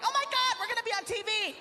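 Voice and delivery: high-pitched giddy squeal; mock two girls shrieking with excitement; way up high, over-the-top thrilled; freaking out. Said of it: "they're freaking out".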